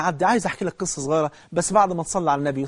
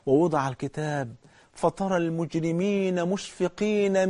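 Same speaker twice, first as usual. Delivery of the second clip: slow, louder tajweed chant rising high; Quran recitation; 4 semitones higher, 4 dB louder, slower than usual. Acted. Preacher reciting a verse to the congregation, slow tajweed chant. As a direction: let the verse ring out with awe.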